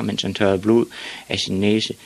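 ordinary delivery

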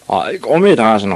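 put-on big boys hollering at their victim; jeering shout. A narrator's reading. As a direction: shout the nickname like a schoolyard bully. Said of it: "råbte de".

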